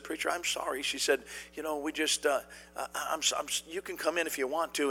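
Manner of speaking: sheepishly sorry at the door; young woman caught asleep apologizes to the preacher, softly; embarrassed hush. Retold or imitated. Embarrassed girl at trailer door.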